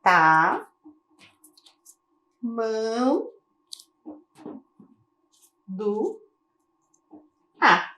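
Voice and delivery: tamanduá read as four separate syllables, long pauses between. Shown as a demonstration. Teacher showing pupils a word divided into syllables, syllable by syllable. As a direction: sound out tamanduá slowly, piece by piece. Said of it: "trouxe a palavra dividida pra gente em sílabas".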